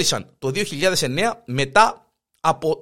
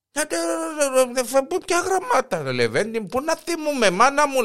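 put-on flustered old wife; 7 semitones higher than usual. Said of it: "λαλεί του".

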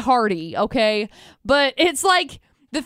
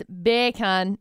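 slow, low "beer can" that sounds like Jamaican "bacon"; Jamaican accent; 6 semitones lower, 4 dB quieter than usual. Shown as a demonstration.

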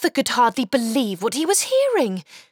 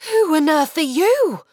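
put-on high, louder child voice, startled; startled boy; 6 semitones higher, 4 dB louder than usual. Boy confronting the creature in the drum, startled. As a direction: ask the stranger in shock.

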